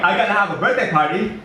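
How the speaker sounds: high, loud big simple man; simple Eddie. Acted. Narrator voicing simple Eddie to the kids, high and loud. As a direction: boom it out like an eager childlike giant. Eddie announcing his party.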